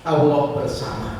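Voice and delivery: slow, weighty "Allah bersama"; solemn slow. Real, not acted. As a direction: say it slowly and with reverence. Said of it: "slow solemn statement". (pelan dan khidmat)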